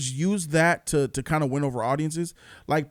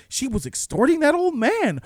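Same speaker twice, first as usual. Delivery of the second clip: lightly voiced shocked detective, a bit higher; 9 semitones higher than usual. Detective reading the document, mildly shocked, raised pitch. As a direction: mildly act out a stunned realization. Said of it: "And he's like".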